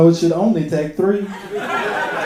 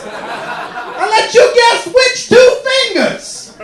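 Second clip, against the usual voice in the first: put-on old man yelling into the wind, much higher and louder; 12 semitones higher, 5 dB louder than usual. Comic playing an old man yelling his online profile, high and loud. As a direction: bellow it like a lonely old man shouting online. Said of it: "like an old man yelling into the wind".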